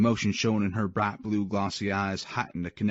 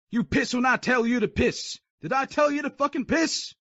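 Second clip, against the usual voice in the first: very high furious yell; furious drill sergeant; 4 dB louder than usual. Acted. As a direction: scream in his face. Sergeant yells back.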